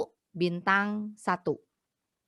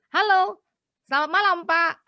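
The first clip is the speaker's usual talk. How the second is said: much higher and louder "Halo, selamat malam"; confident greeting; 11 semitones higher, 8 dB louder than usual. Shown as a demonstration. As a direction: greet with strong aura, "Halo" pitched high and loud. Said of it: "punya harus lebih tinggi".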